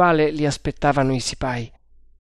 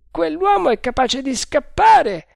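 a higher voiced guard, confident; overheard guard; 13 semitones higher, 5 dB louder than usual. A narrator's reading. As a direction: play the first guard, sure of himself. Guard worried. (guardia sicura di sé, voce più alta)

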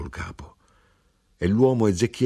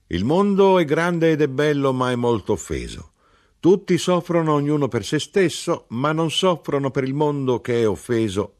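higher, louder earnest recap; solemn old man; 9 semitones higher, 6 dB louder than usual. A narrator's reading. Ezechiele recaps the world's offence to the narrator, solemn and raised. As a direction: preach the sorrow of the offended world.

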